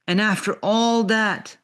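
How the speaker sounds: "all that" stretched high to suggest a long wait; drawn-out delay. Shown as a demonstration. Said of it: "There's a longer delay".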